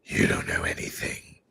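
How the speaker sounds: whispered mock Batman growl; whispered vigilante retort. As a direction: answer the cop in a hushed masked-hero voice. Batman answers the cop.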